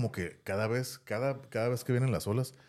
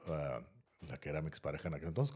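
ordinary talk, nothing marked

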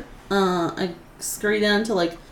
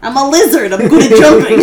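high, shouted brag; loud boast; 4 semitones higher, 12 dB louder, faster than usual. Pretend. Lizard player boasting about a jump, shouted. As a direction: shout it gleefully over the table.

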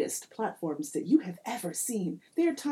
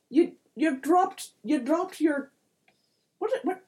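put-on high, halting accented leprechaun voice; flustered little-folk voice, pitched up; 8 semitones higher, 4 dB louder than usual. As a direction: fumble for the word, then call to a mate. Leprechaun asks companion Liam.

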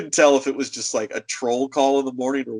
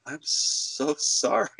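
slow, softer mock-mortified apology; embarrassed apology; 3 semitones higher, 5 dB quieter, slower than usual. Clerk apologizing to a misunderstood customer, embarrassed. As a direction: drag out the apology, squirming. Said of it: "I was so embarrassed".

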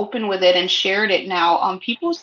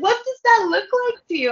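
incredulous; 11 semitones higher, faster than usual. Retold or imitated. Retold challenge, laughing high.

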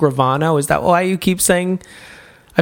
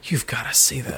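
hushed voice; 7 semitones lower, 8 dB quieter than usual. Retold or imitated. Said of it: "in my bushes going".

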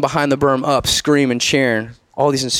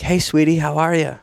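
higher, sweet motherly greeting; lightly voiced mom; 4 semitones higher than usual. Mom answering her son's phone call, sweet greeting.

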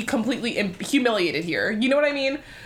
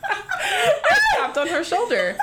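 high, slow, through real laughter; laughing; 13 semitones higher, slower than usual. Real, not acted. Retelling the shoulder tap, laughing helplessly. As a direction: try to restart the story while cracking up. Restarts the line while laughing.

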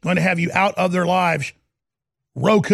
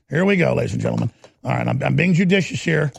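usual speaking voice

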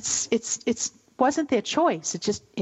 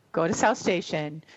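usual speaking voice

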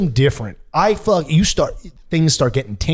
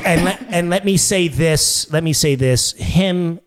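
Donald Trump. Acted. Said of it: "I got Trump and Fat Bastard".

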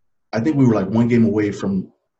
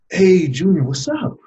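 grandmother's high, lifted hello; imitated upbeat grandma on the phone; cheery phone greeting; 7 semitones higher, 4 dB louder than usual. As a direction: greet your grandson brightly, voice rising sharply.

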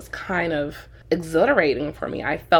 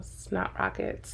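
whispered; 11 dB quieter, slower than usual. Real, not acted. Gross-out comment.

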